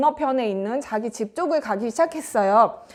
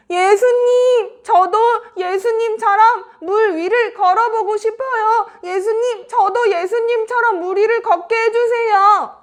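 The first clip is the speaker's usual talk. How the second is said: loud calling out; 8 semitones higher, 4 dB louder than usual. Acted. Peter calling to Jesus once more, high loud pleading. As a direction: holler to someone across the water. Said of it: "이렇게 큰 소리로 외치니까".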